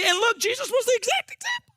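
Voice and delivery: high, breathless and laughing; so fired up the breath runs out; breathless excitement. Real, not acted. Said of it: "I got real excited and ran out of breath".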